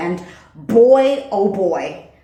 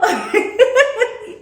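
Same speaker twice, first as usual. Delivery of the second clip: real laugh; 13 semitones higher, 3 dB louder than usual. Real, not acted. Laughs after mocking him.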